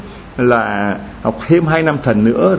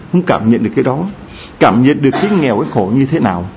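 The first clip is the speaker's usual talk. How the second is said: normal talking voice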